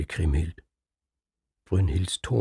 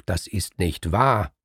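higher and louder outburst; furious, rising protest; flaring up; 4 semitones higher, 5 dB louder than usual. Acted. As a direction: burst out in denial. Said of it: "Da braust Kriemhild auf".

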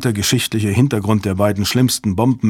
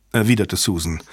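usual speaking voice

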